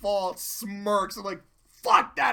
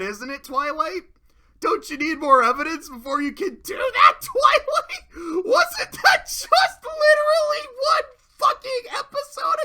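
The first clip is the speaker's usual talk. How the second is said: starts as a sarcastic reminder, ends in a high furious yell; mocking rant building to shout; 5 semitones higher, 7 dB louder than usual. Mocking Twilight's hypocrisy.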